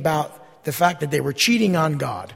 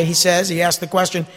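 usual speaking voice

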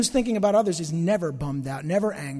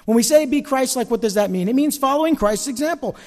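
rising, louder preaching build; 6 semitones higher, 6 dB louder than usual. Acted. Pastor rallying the church, preaching loudly. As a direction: preach it with fire to the congregation.

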